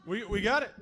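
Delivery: usual speaking voice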